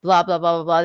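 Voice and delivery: ordinary talk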